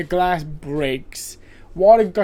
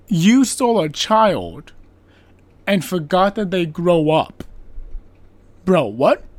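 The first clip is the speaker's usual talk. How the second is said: louder, slow disbelief; incredulous outrage; 5 dB louder than usual. Real, not acted. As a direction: stress each word, you can't believe it. Outraged at the father's logic.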